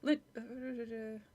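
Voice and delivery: ordinary delivery, nothing marked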